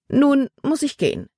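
high lady's voice, falling at the end; announcing departure. A narrator's reading. Frau Weaver tells the artist she must go, high-voiced. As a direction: pitch it up to take leave.

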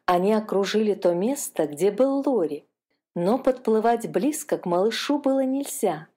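plain reading voice

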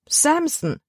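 Libby repeats the dog's name in shock, high and exclaimed; girl's dismayed cry. A narrator's reading. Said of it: "rief Libby".

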